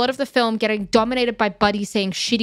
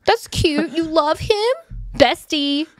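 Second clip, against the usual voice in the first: high, sugary mock sweetness; sweet on top, cutting underneath; passive aggressive; 8 semitones higher, much slower than usual. Friend at the salon, passive-aggressive and high. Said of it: "I am really passive aggressive".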